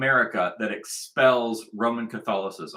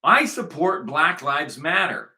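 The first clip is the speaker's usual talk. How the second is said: slow, higher imitation of the Pope; mock Pope declaration; 5 semitones higher, 4 dB louder, slower than usual. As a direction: proclaim it slowly as the Pope. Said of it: "Like, the Pope will say".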